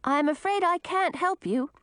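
high-pitched voice calling back; young woman's high, carrying reply. A narrator's reading. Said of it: "Millie called back".

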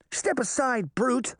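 put-on high-pitched boy's command; high, bossy little boy; haughty wooden boy. A narrator's reading. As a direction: order the giant aside in a high voice. Pinocchio orders giant aside.

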